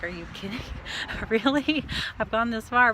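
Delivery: rising, incredulous surprise; amazed it went so fast; surprised disbelief. Retold or imitated. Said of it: "I was like".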